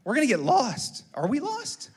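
high, loud imitated child's alarm; mock-panicky daughter, rising at the end; worried daughter. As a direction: mimic a little girl fretting about getting lost. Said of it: "she said".

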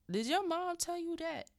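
high, loud mock-alarmed question; startled demand. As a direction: retell a father's sudden loud challenge. Suspicious father questioning his son.

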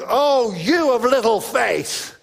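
loud, slow, stern rebuke; stern booming rebuke, drawn out; strong strident Jesus. Shown as a demonstration. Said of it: "a kind of strong, strident Jesus going".

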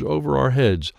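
usual speaking voice

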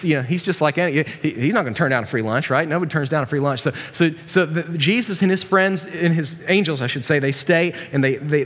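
ordinary delivery

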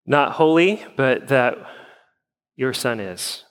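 measured, subdued pastoral prayer; congregational prayer. Acted. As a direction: pray evenly for your church, pausing between petitions.

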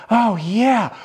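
mock seductive moan; romantic moan. Shown as a demonstration. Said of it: "a romantic way of saying".

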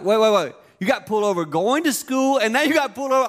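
can't believe it; disbelieving dad. Retold or imitated. Dad hearing about second ticket, incredulous.

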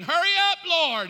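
acted-out exasperated yell; impatient shouted prayer. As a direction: holler it up to heaven, imitating impatience. Re-enacts his impatient prayer.

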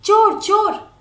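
high, loud shout of alarm; loud alarmed cry. A narrator's reading. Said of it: "Dayanath भी चिल्ला उठे".

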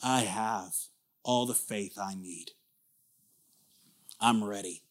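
cocky calm. Acted. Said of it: "the other guy says".

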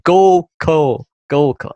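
goal call with softened northern vowels; switched accent. Shown as a demonstration. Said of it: "I'm switching".

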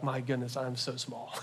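soft, fading hush of awe; quiet awestruck murmur; awed and small. Retold or imitated. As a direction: let it sink to a near hush, humbled. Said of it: "you're like".

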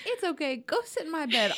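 high-pitched imitation of a soothing mom; comforting mom. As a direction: coo it like a mother to a hurt child. Repeats the mom's comforting words.

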